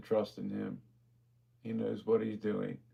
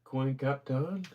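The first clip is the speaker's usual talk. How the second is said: reading an odd name slowly, rising; drawn-out baffled question; puzzled slow read; 4 semitones higher, much slower than usual. Real, not acted. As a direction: sound out a strange name, puzzled. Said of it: "Is that what that says?".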